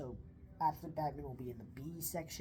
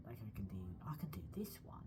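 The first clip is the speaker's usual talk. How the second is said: whispering; 7 semitones lower, 6 dB quieter than usual. Shown as a demonstration. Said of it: "whispered demo voice".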